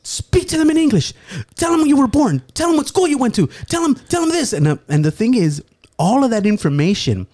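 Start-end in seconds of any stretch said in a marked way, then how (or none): 0.0-4.5 much higher, urgent rapid commands; frantic mom orders. Retold or imitated. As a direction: drill him like a panicked mother. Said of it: "she goes full on".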